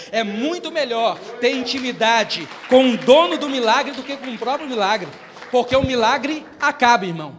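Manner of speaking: loud, driving pulpit climax; full-force preaching. Acted. Preacher urging the congregation toward intimacy with Jesus, shouting passionately. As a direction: hammer it home to the congregation.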